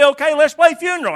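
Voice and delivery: high, loud eager call; a kid trying the next game with gusto; preacher voicing a child shouting a new game. Children's game quarrel acted out.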